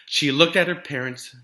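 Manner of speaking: ordinary delivery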